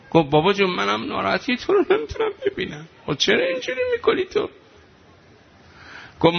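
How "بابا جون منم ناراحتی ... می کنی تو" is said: put-on child's voice. Child voice pleading to mother.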